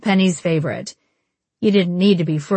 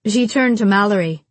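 plain reading voice

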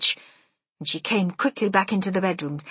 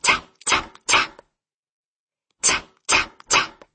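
whispered tapping noise; tapping sound; 4 dB louder, slower than usual. A narrator's reading. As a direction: tap out the knock with your breath. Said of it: "tap, I went".

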